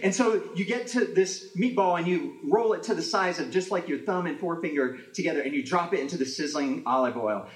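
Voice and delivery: ordinary delivery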